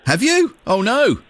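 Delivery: high, playful surprise; mock surprise. Real, not acted. Host reacts to ants.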